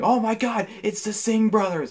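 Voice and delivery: high, hyped-up mock announcer shout, slowing on the name; sarcastic impression of an overexcited play-by-play call; mock announcer yell. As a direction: ham up the commentator selling a fake threat. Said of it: "Cole tried to make him sound like a threat by yelling".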